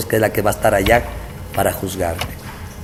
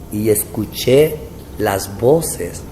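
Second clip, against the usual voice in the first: ordinary talk, nothing marked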